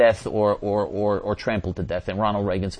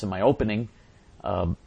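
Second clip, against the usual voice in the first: normal talking voice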